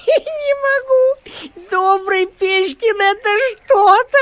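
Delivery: slow words squeezed out through laughter; helpless laughter, almost tears; laughing. Acted. Dog laughing at the kind postman, words through laughter.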